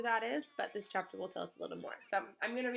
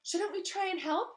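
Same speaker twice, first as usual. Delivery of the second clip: awkward question; 10 semitones higher, 5 dB louder than usual. Acted. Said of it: "Harry began awkwardly".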